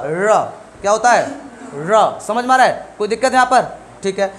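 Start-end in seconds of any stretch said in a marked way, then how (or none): 0.0-0.5 र with tongue curled back, pitched lower; low, heavy curled र; retroflex r. Shown as a demonstration. Teacher giving the correct sound, retroflex र. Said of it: "इसका सही उच्चारण क्या हुआ". 1.7-2.2 र with tongue curled back, pitched lower; low, heavy curled र; retroflex r. Shown as a demonstration. Teacher repeating the correct sound, retroflex र. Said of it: "इसका सही उच्चारण क्या हुआ".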